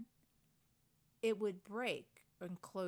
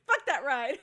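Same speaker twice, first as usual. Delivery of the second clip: louder mock-angry laughing curse; 4 semitones higher, 12 dB louder than usual. Real, not acted. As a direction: spit it out while laughing. Laughing rant about the ride.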